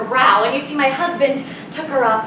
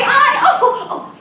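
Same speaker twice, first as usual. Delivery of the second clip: imitating a crying baby; 11 semitones higher, 3 dB louder, slower than usual. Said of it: "leave crying and say".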